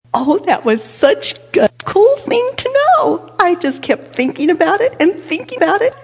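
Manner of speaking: high, excited retelling of a thrill; thrilled child. Retold or imitated. Child delighting in Mama's secret about the turkey's nest, thrilled.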